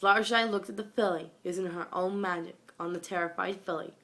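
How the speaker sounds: plain reading voice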